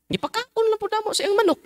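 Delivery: high, squeaky mock penitent voice; mock excuse. Priest mimics a penitent justifying the theft, high squeak. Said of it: "mock penitent's excuse".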